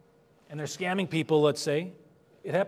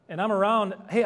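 normal talking voice

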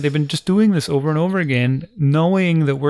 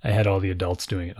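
usual speaking voice

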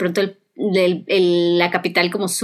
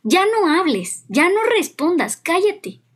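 mother snapping; 7 semitones higher, slower than usual. Retold or imitated. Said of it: "Y un día me dijo".

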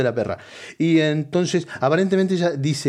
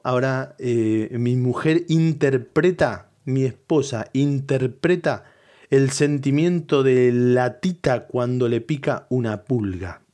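ordinary talk

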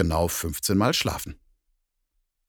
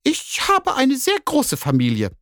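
much higher, snippy cat voice; 5 dB louder than usual. Acted. Cat snaps back at the doubting bear, high and snippy. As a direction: toss it back haughtily. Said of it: "entgegnete die Katze ihm schnippisch".

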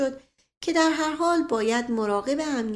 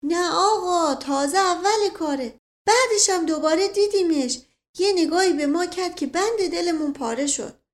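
a slightly higher, lightly voiced village servant, chatty; old servant Mashqasem; 7 semitones higher, 4 dB louder than usual. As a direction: tell it like a simple old servant who loves a tale. Mashqasem continues his tale.

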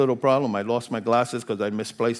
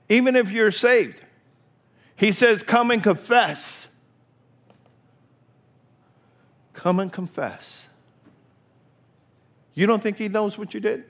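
high, loud revival preaching; fervent sermon at the top of the voice; full-force preaching; 10 semitones higher, 7 dB louder than usual. Acted. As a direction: preach it out to the back row.

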